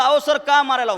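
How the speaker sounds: loud, high tearful protest at the teacher; crying protest. Retold or imitated. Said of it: "रडत रडत म्हणलं".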